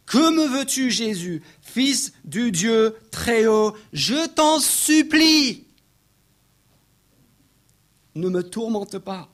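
high, loud, drawn-out plea; loud cry. Acted. Demoniac begging Jesus, in a loud cry. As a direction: cry out slowly, building to a desperate peak. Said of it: "s'écria d'une voix forte".

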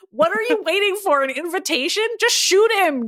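high, impatient outburst; crying out. A narrator's reading. Katie pushes Maddie to attack, crying out. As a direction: urge it out impatiently. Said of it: "Katie cried out".

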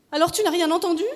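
high, loud questioning; insistent wife. A narrator's reading. Wife presses her question.

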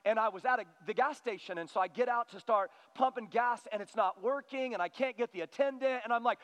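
ordinary talk, nothing marked

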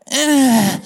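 strained little "eh" in imitation; feeble effort grunt. Hunter too frozen to throw, imitated feeble grunt. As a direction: mimic a weak throw with a grunt. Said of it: "like that".

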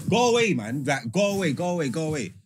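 alarmed brush-off. Retold or imitated. Storyteller to grabbing women on seeing bag open, alarmed. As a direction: repeat it urgently to drive them off. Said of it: "when I see my bag open".

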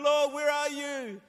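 high-pitched desperate prayer, acted; acted heartbroken appeal to God; crying out. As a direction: plead upward, then pour out the troubles. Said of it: "you're crying out and going".

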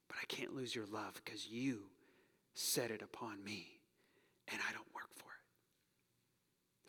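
slow, soft whisper; whispered confession. Real, not acted. Pastor confessing to God in prayer, whispered. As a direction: confess it under your breath.

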